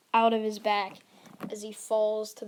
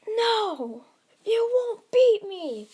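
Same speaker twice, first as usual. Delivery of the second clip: high, loud battle cry, drawn out; full-out heroic shout; defiant yell; 12 semitones higher, 10 dB louder, slower than usual. Acted. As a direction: yell defiance at a powerful enemy. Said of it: "as Deku yells out".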